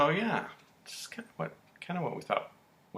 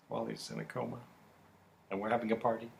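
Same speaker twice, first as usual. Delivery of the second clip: usual speaking voice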